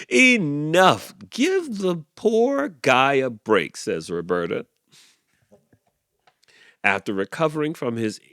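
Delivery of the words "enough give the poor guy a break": high, slow scolding; scolding goose. A narrator's reading. Goose scolding the animals for Clyde, slow scold. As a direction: scold them, slow and firm.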